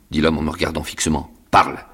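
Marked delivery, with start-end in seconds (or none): 1.5-1.8 loud rough command; stern order. Acted. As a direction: push him to speak. Convict orders boy.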